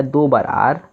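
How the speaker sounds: normal talking voice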